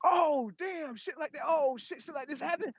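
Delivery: high, mocking, amused darkness voice. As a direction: jeer at the light from way up high. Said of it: "the light like".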